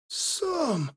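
very soft, high, strained voice; weak gasp of a dying man; barely audible. A narrator's reading. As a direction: force out the name faintly. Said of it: "проговорил едва слышным голосом Кулым".

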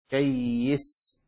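stretched, doubled ya in كيس. Shown as a demonstration. Teacher spelling out a hadith word, doubled ya in كيس. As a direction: say كيس slowly, holding the doubled ya. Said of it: "بتجديد الياء".

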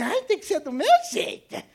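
put-on high, whiny girl insisting on her own way. Preacher mocks a stubborn young girl, whiny and high. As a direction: mimic a spoiled girl who wants it her way. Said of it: "uma mocinha meio assim".

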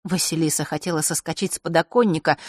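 usual speaking voice

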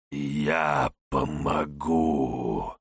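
low, very slow rasping shadow; creeping nightmare whisper. Acted. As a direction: rasp it out like a dark shade. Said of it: "вновь хрип тени".

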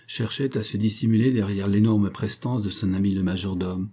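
ordinary delivery

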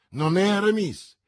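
loud, high, emphatic reply; echoed exclamation. A narrator's reading. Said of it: "da questa vostra esclamazione capisco". (replica esclamata, forte e acuta)

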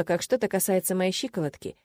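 usual speaking voice

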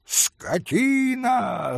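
high, long-drawn cry of outrage; yelled insult. A narrator's reading. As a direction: stretch it out high, falling at the end. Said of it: "заорал было пан Врублевский". (протяжный возмущённый крик)

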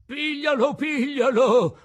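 loud, high shout after a runaway. Acted. Geppetto shouting to passers-by to catch Pinocchio. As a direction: yell to the street to catch him. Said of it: "urlava Geppetto".

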